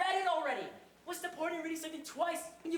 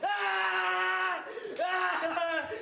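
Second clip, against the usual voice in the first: higher, louder cries of alarm; panicked yelling; 5 semitones higher, 4 dB louder, much slower than usual. Acted. Banker yelling as the woman clutches his foot, panicked. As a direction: yelp as she grabs your foot.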